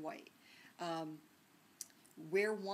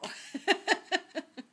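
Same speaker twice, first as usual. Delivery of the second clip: loud, high burst of laughter; real laugh; 12 semitones higher, 12 dB louder than usual. Real, not acted. Lecturer amused by her own roommate story, loud laugh.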